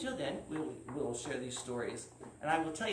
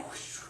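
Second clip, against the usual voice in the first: breathy flying whoosh; 8 dB quieter than usual. Acted. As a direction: blow out a quick swoosh. Dragon flies off.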